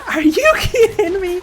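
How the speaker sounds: laughing disbelief. Real, not acted. Reacting to game twist.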